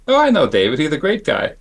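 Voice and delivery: slight lisping impression of an acquaintance; Dick Smith lisp. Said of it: "He had a little bit of a lisp".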